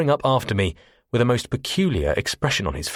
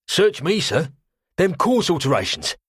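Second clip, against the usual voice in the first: cockney quartermaster; 10 semitones higher, 3 dB louder than usual. Acted. Cockney quartermaster voiced high.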